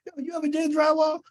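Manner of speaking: high, fast, doubtful yo; incredulous question. Retold or imitated. Banker asks the solo builder, fast and incredulous. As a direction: fire it off in disbelief.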